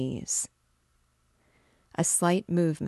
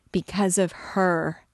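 "because of her" spoken aloud in a slightly louder, anguished voice; anguished accusation; 3 semitones higher, 4 dB louder than usual. Acted. Priest blaming the girl.

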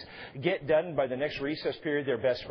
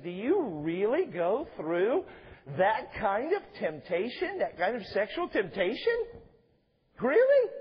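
slow, rising disbelief climbing to a high squeak on really; drawn-out amazed asking; incredulous question; 5 semitones higher, slower than usual. Retold or imitated. Wife to husband after reading the book, incredulous and rising.

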